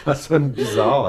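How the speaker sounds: stuffy nose while laughing; blocked nose. Real, not acted. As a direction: talk through a blocked nose. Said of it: "j'ai le nez bouché".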